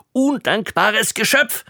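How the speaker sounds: high scornful cry; indignant outburst. A narrator's reading. King scolding his ungrateful youngest daughter, high and indignant. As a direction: hurl the insult at her.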